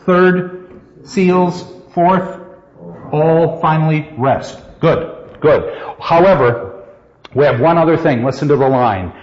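normal talking voice

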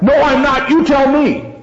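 a nervous guard half-yelling a demand; mock yell. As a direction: call out to the figure in the dark. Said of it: "the other figure yells out".

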